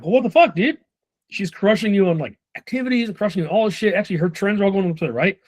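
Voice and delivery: high, incredulous what-the-heck to a rep; baffled, almost laughing at how strange it is; bewildered disbelief. Retold or imitated. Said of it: "I'm completely bewildered".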